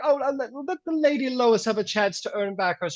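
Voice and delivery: pleading crowd. Acted. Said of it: "there's some people like".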